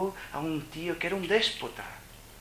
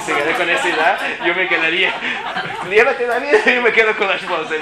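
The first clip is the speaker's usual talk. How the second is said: loud, high and laughing through it; laughing mock robber; 6 semitones higher, 15 dB louder than usual. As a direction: ham up the thief while cracking up. Joke robber's reply, laughing. (entre risas, ladrón de broma)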